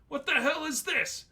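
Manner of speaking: high disgusted manager voice. A narrator's reading. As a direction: spit it out in disgust. Manager looks at brochure in disgust.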